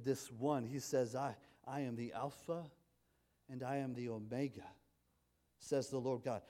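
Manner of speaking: quiet awestruck sermon voice; hushed awe. Acted. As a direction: drop to a reverent hush.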